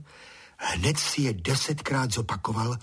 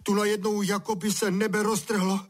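high-pitched lament; wailing; 10 semitones higher than usual. A narrator's reading. Drenched Honza tells father what hit him, wailing. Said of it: "bědoval Honza".

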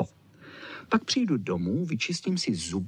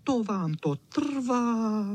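high, slow complaining dog voice; grumbling little dog; 12 semitones higher, much slower than usual. A narrator's reading. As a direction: grumble impatiently in a high, drawn-out voice. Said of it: "vrčel Č". (vysoké, protáhlé psí bručení)

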